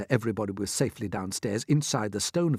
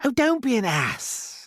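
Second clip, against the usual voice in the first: slow, high creature voice; scornful; 12 semitones higher, slower than usual. A narrator's reading. Centipede scolding the earthworm, scornful. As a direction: dismiss him with scorn. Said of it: "the centipede told him".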